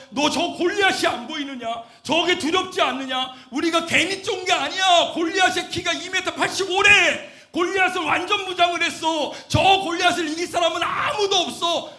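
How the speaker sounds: loud, high-pitched acted warning; shouted dissuasion. Soldiers warn young David off fighting Goliath, shouting urgently. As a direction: shout them out of it, hammering each reason. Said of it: "뜯어 말렸어요".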